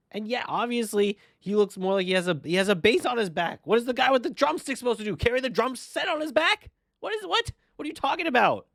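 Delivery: incredulous. Real, not acted. Mocking the drumstick logic.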